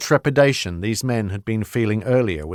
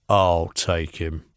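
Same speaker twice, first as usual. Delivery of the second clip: low menacing voice; 5 semitones lower, slower than usual. A narrator's reading. Jones speaks to orderlies.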